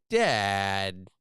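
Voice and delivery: lightly imitating a little boy's surprised "Dad"; a hint of a small son spotting dad; cute little boy. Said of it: "in the cutest little boy way".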